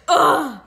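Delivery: loud annoyed groan. Acted.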